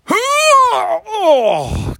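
high loud cry while dropping onto the couch; real exertion cry on sitting heavily; groaning shout. Real, not acted. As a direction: let out a big strained yelp as you flop down. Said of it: "You'll hear what it's like when I sit down".

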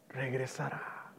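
sudden drop to a low, very soft voice; hushed promise. Acted. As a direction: let the word fall almost to nothing. Hushed end of the proclamation. (promesa en voz muy baja)

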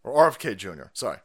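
ordinary talk, nothing marked

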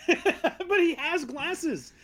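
high, drawn-out objection through laughter; laughing protest. Real, not acted. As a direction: protest while cracking up. Laughing protest about the glasses.

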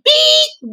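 high, drawn-out spoken beep; self-censor bleep. Real, not acted. Podcaster censoring a word she can't say, bleep imitation. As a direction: hold a high beep over the forbidden word.

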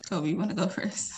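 soft unvoiced aside; whisper. Real, not acted. Whispering to Kobe to answer first. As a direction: hush and pass the turn. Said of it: "whispered handoff".

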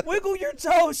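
high, loud shouted instruction; urgent yell. Retold or imitated. Husband coaching wife through cramp, urgent yell. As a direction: yell it over someone's cries.

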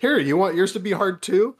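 hammed-up father talking to his kid; dad in skit. Retelling a comedy skit as the dad, high loud mock father. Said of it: "It's like the Tom Segura si-, skit".

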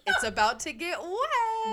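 high, loud laughing line. Real, not acted. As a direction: crack up while saying it. Cracking up at the quote.

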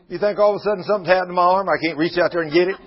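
fast, rushed divine challenge; God voiced rapidly. God challenging Moses about his arm, fast. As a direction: rattle off God's challenge quickly. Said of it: "He says".

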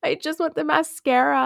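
high-pitched mock plea; mock pleading. Frustrated shopper begging for mascara, mock pleading. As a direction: plead half-joking, pitched well up.

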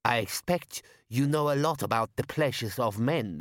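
sour. Acted. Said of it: "said mother-in-law sourly".

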